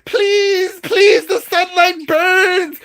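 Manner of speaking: hammed-up agony; burning witch screaming. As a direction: shriek like the sunlight is scorching you. Witch burned by the sunlight.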